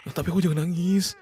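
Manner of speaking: put-on high, coaxing plea to a child; gentle pleading. Father pleads with crying child. (membujuk anak dengan nada tinggi)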